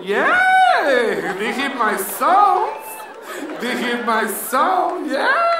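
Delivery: milk the ache in her own reply; hammed-up lament with a squeak at the end; mock pained cry. Her reply, voiced loudly.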